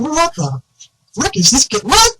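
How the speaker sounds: incredulous disbelief. Retold or imitated. Guy hearing the fishing-voices story, retold stunned disbelief. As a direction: replay your own baffled reaction to his story. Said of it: "And I'm sitting there going".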